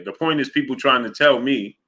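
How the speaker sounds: normal talking voice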